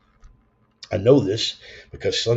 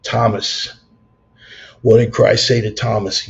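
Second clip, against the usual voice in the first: louder, preacher-like question; emphatic question; 7 dB louder than usual. Real, not acted. Putting Christ's words to the listener, loud and preacherly. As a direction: ask it like a preacher.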